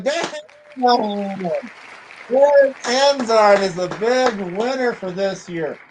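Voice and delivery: high, slow, drawn-out announcement of the winner; excited winner reveal. Real, not acted. Host announcing the month's giveaway winner, excited and drawn out. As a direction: call the winner's name like a game-show host.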